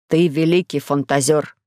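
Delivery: low-pitched, understated admiration; a touch of awe; admiring. A narrator's reading. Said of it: "восхищённо говорит он".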